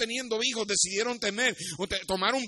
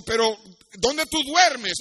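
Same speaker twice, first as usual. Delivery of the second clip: much higher, louder question; insistent king; 4 semitones higher, 6 dB louder, slower than usual. Acted. The king asks a street child where he sleeps, loud and insistent. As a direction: press the child with a raised, incredulous question.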